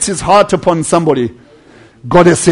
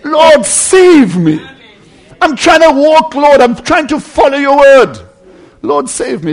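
slow, loud, raised plea for rescue; crying out; 7 semitones higher, 4 dB louder, slower than usual. Acted. Believer sinking cries to the Lord, loud slow plea. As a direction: cry it out high and drawn out. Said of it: "cry out to him".

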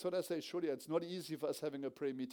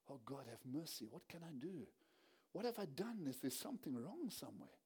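soft, pleading prayer by the chair; quiet desperate prayer; 3 semitones lower, 10 dB quieter than usual. Retold or imitated. Kneeling father praying quietly to God, soft and pleading. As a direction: murmur it low, begging God.

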